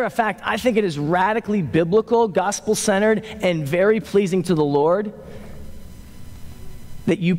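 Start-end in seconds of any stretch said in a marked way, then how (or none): none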